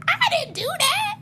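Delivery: very high, squeaky Trump voice; mock Trump's squeaky denial. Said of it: "Trump says".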